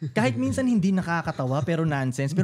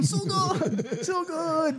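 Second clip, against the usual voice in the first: high war cry through laughter; laughing battle cry; 12 semitones higher, much slower than usual. Retold or imitated. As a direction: yell charge while cracking up. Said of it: "gagano-gano siya". (natatawang sigaw ng pagsugod)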